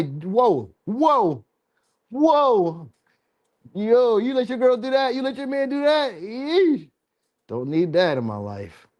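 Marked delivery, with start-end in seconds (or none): 0.0-6.9 high, disbelieving whoa rising into a yeesh; mock shock. Real, not acted. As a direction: act scandalised at what they let their partner do. Shocked reaction to kinky partners.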